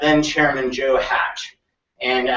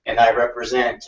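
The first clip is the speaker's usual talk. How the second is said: ordinary delivery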